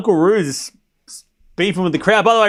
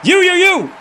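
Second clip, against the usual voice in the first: jab each you at the crowd; imitating a fan pointing and shouting; 11 semitones higher, slower than usual. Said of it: "He's like".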